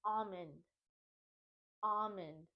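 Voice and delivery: almond said AH-mun; "almond" with an open AH and no L; silent L. Shown as a demonstration. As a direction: model almond without the L. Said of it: "we don't pronounce the L".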